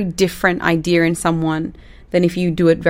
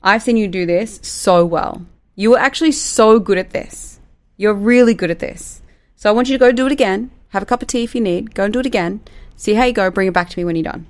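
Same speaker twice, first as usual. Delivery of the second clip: higher, brighter-pitched coaxing praise; warm encouragement; 4 semitones higher than usual. Shown as a demonstration. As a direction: lift the voice and cheer them on gently. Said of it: "here's how you could say it if you embody these".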